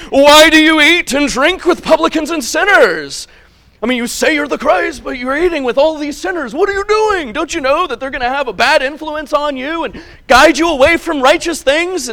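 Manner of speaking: high, loud scolding of the fundamentalists; mocking Pharisees. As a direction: sneer it like an indignant Pharisee. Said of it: "they said".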